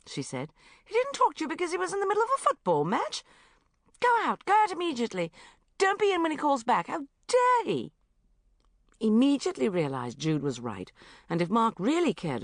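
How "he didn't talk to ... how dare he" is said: indignant friend. Acted. Jude outraged on Bridget's behalf.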